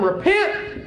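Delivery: high, ringing call to repent; preacher's exclamation. Retold or imitated. Preacher calling new converts to repentance, exclaiming. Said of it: "Preacher gets up and he says".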